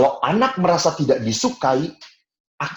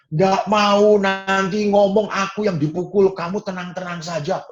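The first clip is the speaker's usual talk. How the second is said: indignant refusal; 9 semitones higher, 4 dB louder than usual. Retold or imitated. Narrator as boy refusing sibling.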